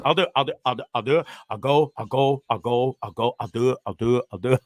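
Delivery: repeated low resolve, barely changed from his own voice; Ferris Bueller impression. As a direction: mutter it over and over like a timid kid psyching himself up. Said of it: "That's my best, uh, Ferris Bueller, uh, impression".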